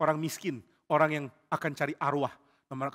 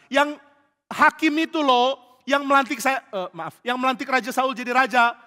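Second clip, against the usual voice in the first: insistent; 8 semitones higher, 7 dB louder than usual. Acted. Saul insisting to medium.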